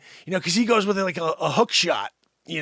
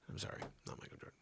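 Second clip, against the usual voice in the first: whisper; 26 dB quieter, much faster than usual. Real, not acted. Whispered self-correction aside.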